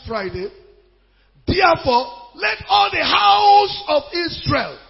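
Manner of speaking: loud, rising proclamation; preacher thundering out the verse; declamatory scripture reading. Real, not acted. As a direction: build to a high, ringing proclamation. Reads scripture at full force.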